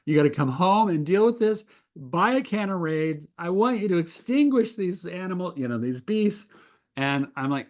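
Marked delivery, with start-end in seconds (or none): 0.1-6.5 lightly mimicked wife, high-pitched and insistent; a hint of a frantic wife ordering; wife demanding. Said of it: "she's like".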